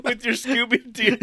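giggling as he echoes the joke; laughing. Real, not acted.

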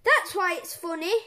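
loud gleeful explanation; cheeky reply. Acted. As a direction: defend the joke with glee. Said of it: "said George".

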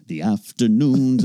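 usual speaking voice

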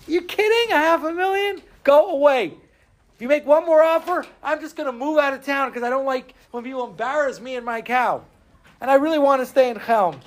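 high, scornful dismissal; offended cow owner. Acted. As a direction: wave them away, insulted. Owner rejects half a million.

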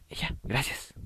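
breathy whispered thanks, almost secret. Pretend. Agreeing to the motel in a hushed whisper. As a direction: murmur it under your breath, unvoiced. Acted reply, whispered aside.

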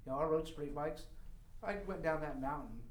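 ordinary delivery

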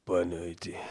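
sensual Lula. Retold or imitated. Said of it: "o Lula sensual".

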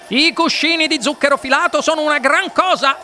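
high, excited, rushing showman; Wonka di corsa. A narrator's reading. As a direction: exclaim it high and proudly on the run. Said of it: "commentò di corsa il signor Wonka". (commento entusiasta e acuto, detto di corsa)

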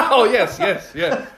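high, loud laughing agreement; giddy laughing yes. Real, not acted. Laughing agreement after joke.

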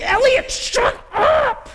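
put-on high, loud, drawn-out yell at a child; acted angry shout. Parent losing it at a screaming six-year-old, acted shout. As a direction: blow up at her, stretching every word. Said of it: "shaking her and saying".

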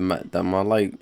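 normal talking voice

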